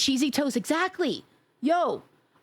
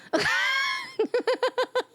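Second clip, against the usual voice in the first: shrieking laugh. Real, not acted. Streamer howling at her own joke, shrieking laugh. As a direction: let a big laugh rip.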